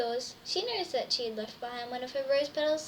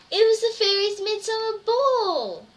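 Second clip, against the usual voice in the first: high, loud thrilled announcement; excited reveal; 8 semitones higher, 9 dB louder than usual. A narrator's reading. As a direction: announce it with a burst of joy. Big reveal in story.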